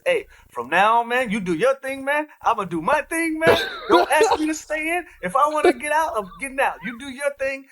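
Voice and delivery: put-on hamming up a thick Italian accent to tease. Teammate imitating Gallo telling Pat Bev off, in a thick Italian accent. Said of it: "In his thick Italian accent".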